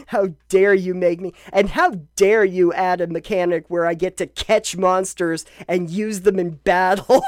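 playfully loud fake indignation; shaking with anger. Mock outrage at game sequel.

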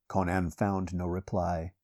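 ordinary delivery, nothing marked

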